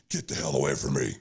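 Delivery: put-on angry player telling a kid off; gruff brush-off. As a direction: growl it at the pesky kid.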